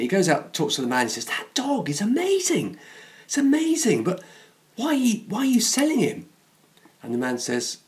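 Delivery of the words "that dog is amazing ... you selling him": high-pitched, acted astonishment; incredulous buyer in a joke; amazed. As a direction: marvel with the voice pitched right up. Joke's man amazed at the dog.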